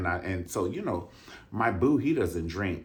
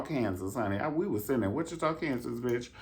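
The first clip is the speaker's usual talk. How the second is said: normal talking voice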